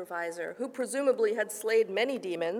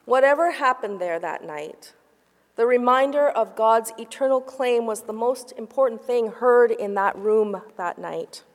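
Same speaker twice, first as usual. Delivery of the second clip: usual speaking voice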